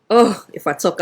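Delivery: normal talking voice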